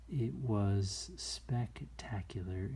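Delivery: slow whispered wonder; awed whisper. Real, not acted. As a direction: whisper it in awe. Awed memory of bighorns.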